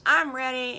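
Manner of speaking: high, loud eager piglet; eager to leave; eager kid. Acted. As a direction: chirp it eagerly. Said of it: "And Piggly says".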